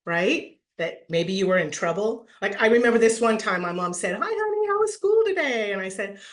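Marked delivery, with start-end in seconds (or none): 4.1-5.7 high, sugary motherly voice; sweet mom greeting. Retold or imitated. Mom greeting her kid home from school, sugary high. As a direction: sound like a mom who already knows. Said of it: "when your mom's tone of voice changed".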